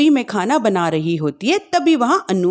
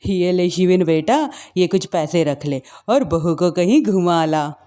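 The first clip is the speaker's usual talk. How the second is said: put-on lower, doting older woman; sweetly favoring her own son; wicked stepmother; 6 semitones lower than usual. Mother-in-law voiced by narrator.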